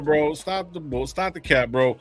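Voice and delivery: usual speaking voice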